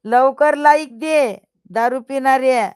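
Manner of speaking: a mock-angry loud demand aimed at the drinker; playful demand. Real, not acted. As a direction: boss the viewer into liking. Demanding likes from the viewer.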